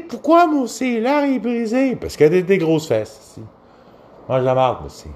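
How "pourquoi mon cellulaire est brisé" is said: mocking ditzy woman. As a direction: drag it out in a squeaky high voice. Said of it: "Elle dit".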